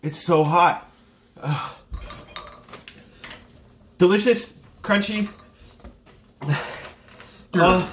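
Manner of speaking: normal talking voice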